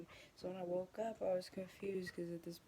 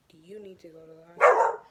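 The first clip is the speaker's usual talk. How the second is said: urgent; 25 dB louder than usual. Retold or imitated. Urgent question to snake-bitten friend.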